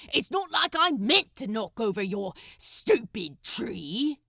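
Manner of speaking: loud, scornful snap; defensive snap. Acted. Crowley snapping at Aziraphale about his stupid tree, loud. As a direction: lash out defensively. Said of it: "he snapped defensively".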